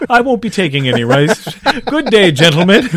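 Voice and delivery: bow out grandly in a squeaky voice, breaking into a laugh; mock polite translator. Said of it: "Yeah, yeah".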